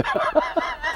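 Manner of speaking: high real burst of laughter; a sudden real laugh; loud laugh. Real, not acted. Laughing at his claim.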